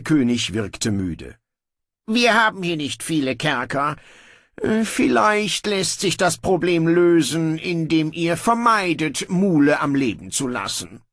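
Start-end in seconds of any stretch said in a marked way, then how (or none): 2.1-11.0 higher, breezy royal voice; a lazy king giving in; offhand young king. Acted. Brocando grudgingly orders guards to release his brother, breezy.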